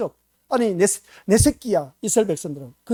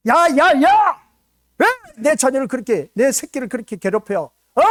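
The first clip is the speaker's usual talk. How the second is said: high, loud furious yelling at the bully; harsh scolding; 7 semitones higher, 8 dB louder, slower than usual. Pretend. Parent shouting at children bullying their kid, furious. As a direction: bellow at the kids who hurt your child. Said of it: "하면서 막 호되게 야단을 칠 겁니다".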